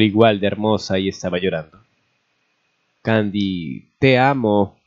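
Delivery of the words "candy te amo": ordinary delivery, nothing marked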